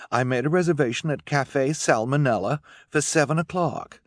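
high, bossy hostess voice; grand airs, pitched well up; Esme. Acted. Esme packing the children off to dinner, grandly bossy.

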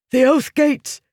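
high, loud urgent cry; urgent shout. A narrator's reading. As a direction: shout the news in panic. Wounded soldier reports breach.

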